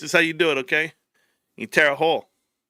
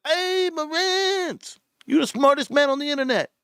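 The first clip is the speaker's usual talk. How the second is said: Ape Father; 9 semitones higher than usual. Retold or imitated. Imitating a YouTuber praising Marantz, high exaggerated impression. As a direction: stretch the hail out high, then gush. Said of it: "I seen the Ape Father".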